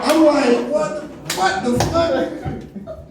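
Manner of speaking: high, incredulous laughing outburst; high voice breaking into laughter; laughing disbelief. Retold or imitated. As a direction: crack up in mock disbelief. Retells his laughing disbelief.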